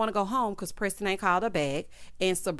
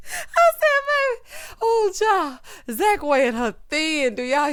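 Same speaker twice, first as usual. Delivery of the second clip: high cracking laughter through the words; laughing hard; 12 semitones higher, 9 dB louder than usual. Real, not acted. Host cracking up at the wine pour, high helpless laughter.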